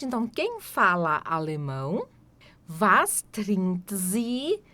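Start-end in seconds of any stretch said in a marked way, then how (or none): 2.8-4.6 "Was trinkt sie?" drawn out very slowly; very slow demo. Shown as a demonstration. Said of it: "teacher models question".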